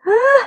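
sudden high loud yelp; surprised shriek. Real, not acted. Vlogger seeing her curled hair, sudden high shriek. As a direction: let out a startled cry.

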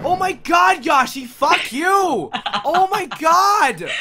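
slow, loud, high scared yell; terrified horror victim. Real, not acted. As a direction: yell at your friend in fright. Scared, yelling at friend.

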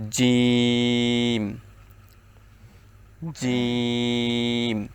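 জিম said firmly, very drawn out; hard jim. Shown as a demonstration. Teacher modelling a hard letter, very slow. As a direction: hold জিম long and hard. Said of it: "আপনি আমার সাথে সাথে পড়ুন".

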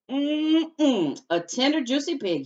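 a hint of a greedy coyote, savouring; hungry coyote. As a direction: lick the lips over a tasty pig. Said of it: "he thought".

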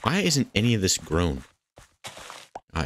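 ordinary talk

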